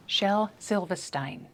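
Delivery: slow Silverstein with r dropped, ending STINE; British pronunciation. Shown as a demonstration. Practising the British version of the name, slow Silverstein as -stine. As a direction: repeat Silverstein as SIL-vuh-STINE. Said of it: "I just found a British pronunciation".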